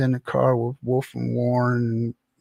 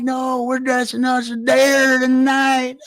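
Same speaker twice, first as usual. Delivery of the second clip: slow, much higher, louder whooping shout; rowdy shout; 10 semitones higher, 5 dB louder, slower than usual. Retold or imitated. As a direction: holler it like a wild buddy at night. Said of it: "And they're like".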